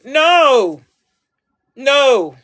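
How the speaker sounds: loud firm no; emphatic no. Real, not acted. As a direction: slam the door on it.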